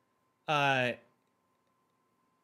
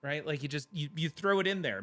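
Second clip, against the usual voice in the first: ordinary talk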